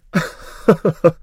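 high, loud laugh. A narrator's reading. Maruf laughs off a disrespectful remark, laughing. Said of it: "aber Maruf lachte".

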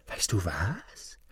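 sly whispered question. Acted. Young lad whispering a deal to Hans, sly. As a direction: breathe it like a secret.

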